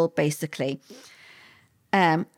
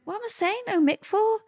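puzzled self-questioning; 13 semitones higher than usual. Retold or imitated. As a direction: question yourself in a high, baffled voice. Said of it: "And I'm thinking".

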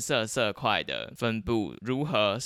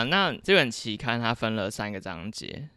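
ordinary talk

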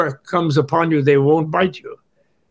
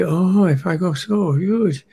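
fretting about getting lost, pitched up; anxious inner voice; 4 semitones higher than usual. Acted. Meditator fears falling into the abyss, mock anxious. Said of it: "where maybe".

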